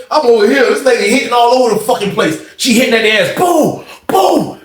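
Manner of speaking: ordinary delivery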